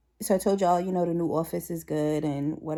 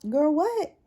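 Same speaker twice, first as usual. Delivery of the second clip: high, disbelieving reaction; incredulous girl what; 10 semitones higher, 4 dB louder than usual. Retold or imitated. Narrator reacting to coworkers' ignorance, high and incredulous. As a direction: silent inner disbelief at ignorant talk. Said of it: "I just be like".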